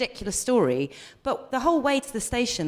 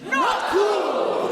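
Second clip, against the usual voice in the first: firm call; 10 semitones higher than usual. Shown as a demonstration. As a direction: call it out firmly. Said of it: "try it as an audience".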